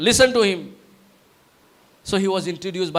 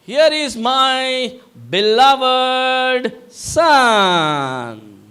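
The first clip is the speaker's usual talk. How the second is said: slow, booming proclamation, higher and louder; ring announcer; 6 semitones higher, 6 dB louder, much slower than usual. Acted. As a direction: stretch every word like an arena announcer introducing a champion. God the Father proclaims his Son.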